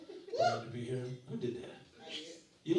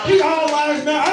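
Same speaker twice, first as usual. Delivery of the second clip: mock shout; 8 semitones higher, 14 dB louder than usual. Crowd-work mock slogan shout.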